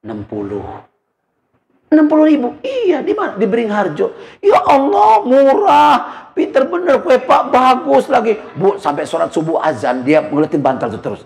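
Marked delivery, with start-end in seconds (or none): 0.0-0.6 lower, much softer casual reply; understated husband naming the price; offhand answer. Retold or imitated. 1.9-8.3 much higher, astonished and delighted; wife amazed the pillow was so cheap. Retold or imitated. Wife amazed at the low price.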